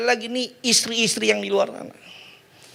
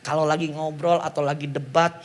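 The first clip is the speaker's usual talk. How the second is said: ordinary talk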